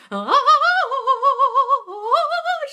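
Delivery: very high helpless laughing; burst of laughter. Real, not acted. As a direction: crack up at the dog. Laughing about singing dog.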